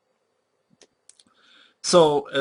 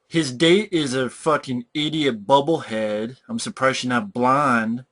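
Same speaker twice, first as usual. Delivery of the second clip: usual speaking voice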